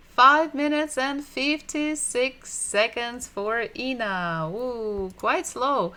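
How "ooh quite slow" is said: usual speaking voice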